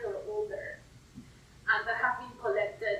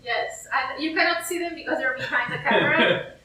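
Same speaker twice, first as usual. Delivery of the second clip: chuckling through the words; laughing; 7 dB louder than usual. Real, not acted. To the host about hidden specimens, laughing. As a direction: let a laugh run under the line. Jokes plants are off camera.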